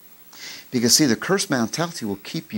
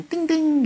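high sung ding ding; mock ding-ding of a bell; 14 semitones higher than usual. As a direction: chime like the station bell. Mimics gas station bell.

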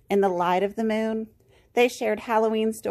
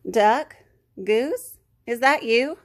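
raised questioning storybook call; 4 dB louder, slower than usual. A narrator's reading. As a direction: separate calls, each rising. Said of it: "the swamp monster called".

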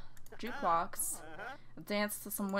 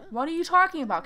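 loud, high and fed up; exasperated shout; 8 semitones higher, 10 dB louder, faster than usual. Acted. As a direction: snap it at the bartender, annoyed. Yelling at laughing bartender.

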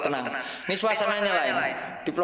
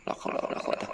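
unvoiced frightened whisper; whispered prayer; 7 dB quieter, faster than usual. Real, not acted. Scared streamer whispering la haula to himself. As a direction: breathe the prayer out silently.

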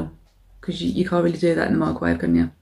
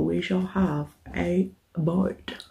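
slow soft murmur; 7 dB quieter, much slower than usual. Acted.

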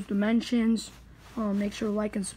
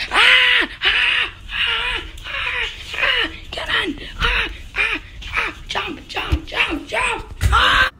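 much higher panicked yells; frantic screaming; 14 semitones higher, 5 dB louder, much slower than usual. Acted. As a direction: scream and shout orders while fleeing. Frantic escape, screaming and calling.

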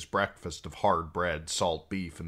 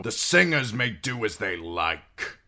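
mild snap; 4 semitones higher, 5 dB louder than usual. A narrator's reading. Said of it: "Stannis snapped".

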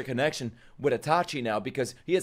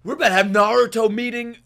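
high, loud, thrilled exclaiming; hyped excitement; 9 semitones higher, 5 dB louder than usual. Real, not acted. Viewer awaiting Naruto meeting Killer Bee, hyped excitement. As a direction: gush with raised voice.

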